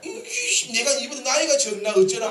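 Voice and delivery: high, laughing mock quarrel; mock angry wife. Pastor mimics a wife scolding her husband, mock anger. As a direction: play the wife snapping back, half in jest. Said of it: "막 이렇게 하지 말고".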